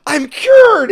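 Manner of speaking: high, loud imitation of exultation; elated cry. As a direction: shout it out, overjoyed and hammed-up. Retelling vampire celebrating in sunlight.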